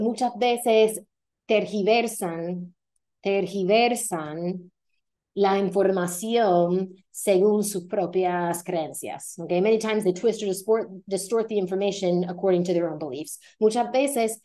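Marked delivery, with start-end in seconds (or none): 0.0-9.4 slow, careful Spanish, each word set down; slow example sentence. Shown as a demonstration. Teacher slowly models a conjugated sentence for learners, careful and clear. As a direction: say "muchas veces tergiversan" slowly, stressing "tergiversan" on "-ver-". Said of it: "Maybe you can say".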